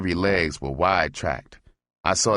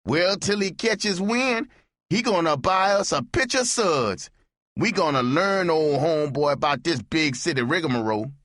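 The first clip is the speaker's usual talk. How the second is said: high-voiced barfly ribbing the stranger; Andy teasing; 12 semitones higher than usual. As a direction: talk down to the greenhorn, high and sly. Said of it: "Andy said".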